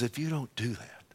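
very quiet, restrained voice of God; hushed warning without any threat; soft warning. Retold or imitated. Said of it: "he doesn't do it with that tone of voice".

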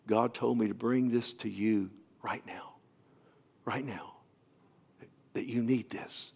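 near-whisper with long pauses; retold solemn hush. Visitor handing the pastor an envelope from God, imitated hushed solemnity. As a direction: deliver it softly, like a sacred message.

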